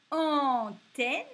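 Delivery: teacher sounding out Antenne; clearly sounded-out word. Shown as a demonstration. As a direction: hit the An at the start of Antenne. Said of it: "on entend au début".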